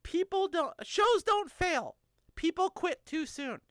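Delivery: slowly quoting mentor; each word given weight; slow, weighty quote. Retold or imitated. Quoting mentor on persistence, slow and weighty. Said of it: "and I quote".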